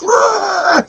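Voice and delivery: hammed-up outburst; mock rage burst. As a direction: let the rage blow up. Venting grief rage.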